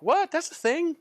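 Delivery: high, incredulous squeak; disbelief. Retold or imitated. Doctor learning of a new drug, high and incredulous. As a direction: sound like you just can't believe it. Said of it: "It's like".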